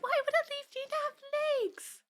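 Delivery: put-on very high, small kid voice; little cartoon kid. Retelling Bluey episode as child finding leaf bug, little kid voice. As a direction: imitate a tiny child puzzling aloud.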